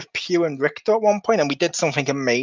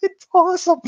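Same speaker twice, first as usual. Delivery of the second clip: ordinary talk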